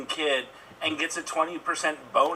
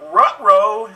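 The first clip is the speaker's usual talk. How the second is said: loud, higher cartoon uh-oh; ruh-roh; 4 semitones higher, 10 dB louder than usual. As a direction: bark it like a cartoon dog. Mock ominous uh-oh.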